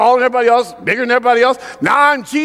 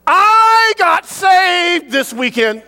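put-on proud little boy; 9 semitones higher, much slower than usual. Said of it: "and I, you know".

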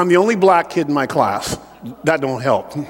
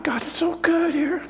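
pious hushed whisper, much higher; churchy whisper; 8 semitones higher, 8 dB quieter than usual. Retold or imitated. Mocking churchgoers' pious church talk, whispered. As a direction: hush it like holy church talk. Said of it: "you have church talk".

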